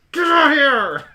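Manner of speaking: high, loud order; in-character shout. Acted. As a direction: yell it across the room. Character shouts for member to come out.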